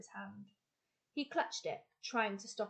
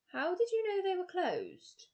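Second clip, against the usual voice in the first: Fred's voice; 10 semitones higher, 4 dB louder than usual. Acted. Fred questions the pilot, surprised.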